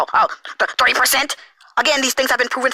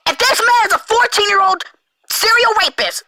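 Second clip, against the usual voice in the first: high, emphatic outraged rant; 10 semitones higher than usual. Real, not acted. Ranting at viewers about the accusation, outraged. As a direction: hit each charge with raised, incredulous outrage.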